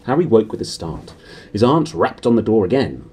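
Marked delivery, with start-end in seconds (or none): none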